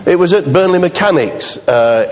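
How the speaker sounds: ordinary talk, nothing marked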